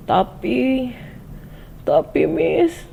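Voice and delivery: high, halting tearful stammer; gemetar menangis. Retold or imitated. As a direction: imitate a trembling, crying pupil. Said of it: "dianya gemeteran nangis gitu". (terbata-bata menirukan tangis)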